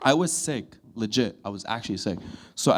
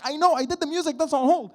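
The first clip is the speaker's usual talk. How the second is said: high, strained exasperation; exasperated son; 14 semitones higher than usual. Retold or imitated. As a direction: squeak it out at mom. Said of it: "I'm like".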